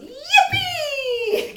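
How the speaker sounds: very high, loud squeal of glee, laughing; joyful dog. Retold or imitated. Trainer voicing her Irish setter after the bath, loud laughing squeal. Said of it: "she goes".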